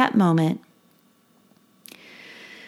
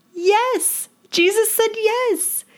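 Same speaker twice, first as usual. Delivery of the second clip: delighted; 13 semitones higher, slower than usual. Real, not acted. Delighted answer after a laugh.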